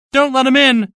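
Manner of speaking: high, loud, desperate pleading; frantic plea. Acted. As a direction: plead from hiding, terrified. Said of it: "Marvel pleaded".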